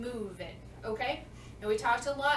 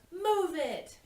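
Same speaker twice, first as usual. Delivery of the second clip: yelled; 6 semitones higher, 4 dB louder than usual. Shown as a demonstration. Everybody yells back to King Julian, a loud shout. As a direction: Move it called out higher and louder. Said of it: "everybody goes".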